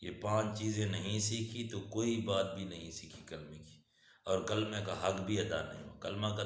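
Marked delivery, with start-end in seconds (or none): none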